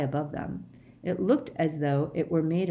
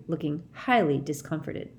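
ordinary delivery, nothing marked

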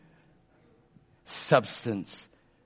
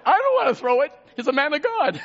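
playful protest; 12 semitones higher, 5 dB louder, faster than usual. Real, not acted. Joking refusal to throw insult.

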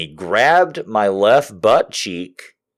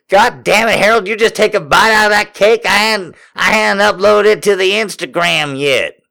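high, scolding character voice; mock outrage, high and hurried; Miss Honeybee; 8 semitones higher than usual. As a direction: scold Harold in a raised, indignant voice. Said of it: "said user Honeybee".

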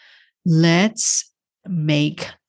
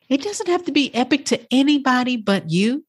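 ordinary talk, nothing marked

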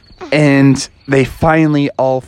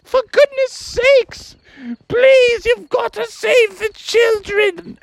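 much higher, slow frantic pleading; panicked mother; slower than usual. Acted. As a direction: beg her husband to save them. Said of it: "she's like".

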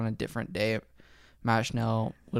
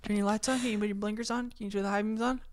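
driving instructor voice; 9 semitones higher, 6 dB quieter than usual. Retold or imitated.

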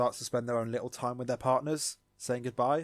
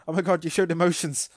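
loud and high, gushing; mock shocked; 6 semitones higher, 9 dB louder than usual. Tentomon shocked by Izzy's love, loud high gasp. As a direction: blurt it in mock amazement. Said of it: "It's like".

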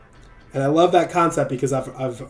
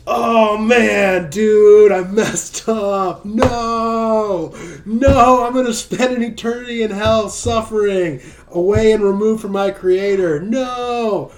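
much higher and louder hammed-up wail of dread; terrified sinner; 7 semitones higher, 6 dB louder, slower than usual. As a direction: cry out in mock terror of hell. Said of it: "So that fear, that".

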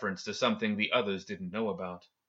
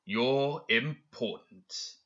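"importance" drawn out very slowly, syllable by syllable; ambiguous, lingering weight on "importance"; strange emphasis on importance; 3 semitones higher, much slower than usual. Acted. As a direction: stretch out "importance" as if it might be sarcasm. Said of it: "He emphasized the last word strangely".

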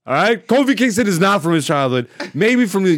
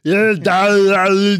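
garbled, drawn-out giant voice, louder; Andre the Giant; 5 semitones higher, 5 dB louder, slower than usual. Acted. Podcaster mimicking giant wrestler's speech, garbled and drawn out. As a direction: slur the words into mush like a giant. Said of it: "He sounds like".

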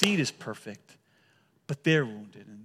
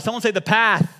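louder, higher call to the crowd; 5 semitones higher, 8 dB louder than usual. Shown as a demonstration. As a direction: push the crowd to shout it back. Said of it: "A little bit louder".

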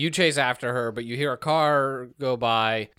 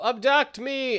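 high, eager call skyward; calling up to the aliens, high-pitched; 11 semitones higher, slower than usual. Retold or imitated. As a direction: call up to the ship. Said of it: "run outside and go".

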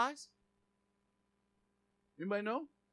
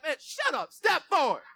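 higher, louder, blunt command; Jesus fed up; 6 semitones higher, 4 dB louder than usual. Retold or imitated. Preacher voicing Jesus silencing the religious critics, fed up. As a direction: cut them off and call the man up. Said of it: "Jesus like".

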